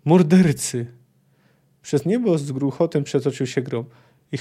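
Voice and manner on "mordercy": a loud accusing cry, a notch below the first; angry shout. Acted. Ciri still crying out into the night, angrily. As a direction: fling the accusation out. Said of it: "krzyknęła w noc".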